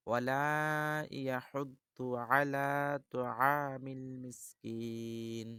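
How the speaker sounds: slow chanted recitation; Quran recitation. Shown as a demonstration. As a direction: recite verse slowly with tajweed. Recites verses again.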